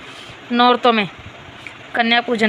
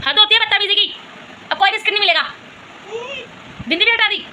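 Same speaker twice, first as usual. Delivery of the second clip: much higher, fed-up scold; exasperated scolding; 7 semitones higher, faster than usual. Real, not acted. Mother punishing daughter for mischief, high exasperated scold.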